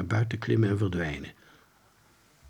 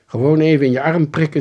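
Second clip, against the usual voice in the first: louder, higher casual instruction; luchtige instructie; 6 semitones higher, 10 dB louder than usual. A narrator's reading. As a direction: hand out the order brightly and loud. Role calmly handing out pins. (luide, nuchtere instructie)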